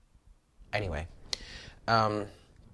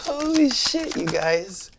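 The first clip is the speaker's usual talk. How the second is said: much higher, loud holy shit; shocked shout; 8 dB louder, slower than usual. Real, not acted. Reader stunned by the spread, calls out to viewers in surprise. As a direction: blurt it out, stunned.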